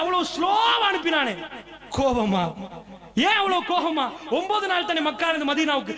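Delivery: high, impassioned sermon cry; fiery preaching. Acted. Preacher pleading with believers, impassioned cry. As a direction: preach it out with rising fervour. Preacher rises to full force.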